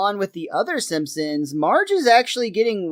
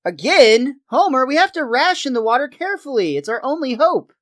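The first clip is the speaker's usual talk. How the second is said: higher put-on voice of an earnest neighbor; pleading Flanders; 7 semitones higher than usual. Flanders urges Homer to ration the water, high pleading. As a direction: plead in a raised, lilting voice.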